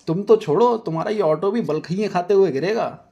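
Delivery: high, loud angry threat. Acted. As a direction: tell him off furiously. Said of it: "Nazia गुस्से में पूछती है".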